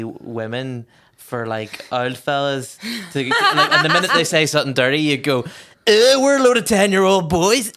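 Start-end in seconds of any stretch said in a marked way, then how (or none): 5.4-7.7 gleeful reveal. Retold or imitated. Kids revealing prank in chat.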